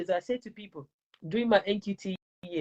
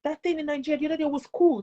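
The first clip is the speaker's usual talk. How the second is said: higher, excited student voice; lightly voiced excited pupil; 6 semitones higher than usual. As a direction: gush like a pupil. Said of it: "the children will come and say".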